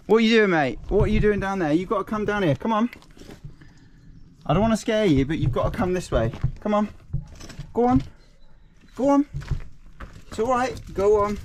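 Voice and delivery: high, encouraging singsong; coaxing an animal. Real, not acted. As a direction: gently urge the piglet along. Coaxing the piglet along.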